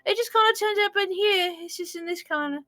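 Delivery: high, strained goblin register. Shown as a demonstration. DM shows cohost her go-to goblin voice, high and squeaky. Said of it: "how I make every goblin sound".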